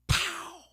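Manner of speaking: high, loud comic bang; a sharp mock spark bang; loud pow sound effect. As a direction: blurt the explosion noise. Spark from cutting the wires.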